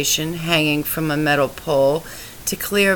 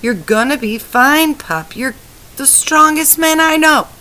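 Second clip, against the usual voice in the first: desperate reassurance; 9 semitones higher, 5 dB louder than usual. A narrator's reading. Wife reassures dying husband.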